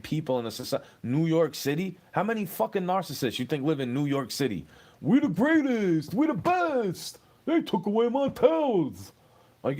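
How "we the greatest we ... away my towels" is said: much higher, louder and slow, puffed-up bragging; boastful New Yorker parody. Acted. Mocking New York narcissists who brag and whine, much higher, slow and pompous. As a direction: ham up a self-important blowhard.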